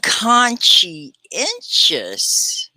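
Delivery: "conscientious" very slowly, each syllable drawn apart; sounded out. Shown as a demonstration. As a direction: stretch out "conscientious" for learners, part by part. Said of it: "like science".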